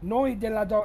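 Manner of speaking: ordinary delivery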